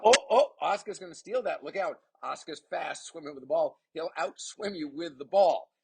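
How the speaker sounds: high-pitched call of a steal; excited commentary. Real, not acted. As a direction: burst into excited play-by-play. Live steal call in match.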